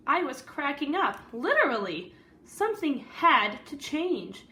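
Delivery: lively read-aloud storytelling voice; story narration. A narrator's reading. Teacher reading a picture book to the class, storyteller voice.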